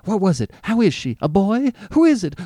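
breathless father firing questions; gasping rush. A narrator's reading. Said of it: "in a gasping rush".

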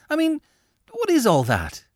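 fed up, demand an answer; exasperated. Acted. Laura demands Angus explain the spread, exasperated.

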